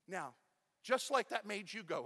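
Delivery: usual speaking voice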